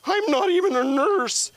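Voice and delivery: very high, loud drawn-out whine; exaggerated squealing protest; whiny nurse. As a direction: whine the correction indignantly. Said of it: "Nurse Whiny Mouth".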